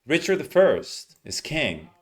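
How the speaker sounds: "Richard the first is king" slow, words run together; slow linked reading demo; linked rhythm. Shown as a demonstration. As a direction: lean on "Richard", link the rest. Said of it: "it actually goes together".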